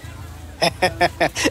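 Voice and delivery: a quiet amused chuckle; soft breathy laugh. Acted. Young man chuckling at the confused brother-in-law, soft laugh. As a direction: laugh softly at the joke. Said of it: "ছেলেটা হেসেই চলেছে".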